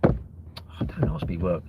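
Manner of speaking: normal talking voice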